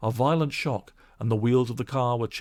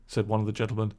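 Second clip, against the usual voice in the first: plain reading voice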